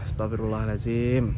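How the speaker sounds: low, hushed sigh of a prayer; quiet murmured prayer. Shown as a demonstration. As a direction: breathe the prayer out softly. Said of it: "gitu ya".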